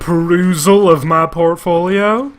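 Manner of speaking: hammed-up snooty art teacher voice; mocking the character. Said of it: "Didn't sound like that".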